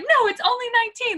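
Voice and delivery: determined brightness, waving it away; insistent excitement. Retold or imitated. Telling husband Brian it's only nineteen, high and eager. Answering Brian's doubt, retold.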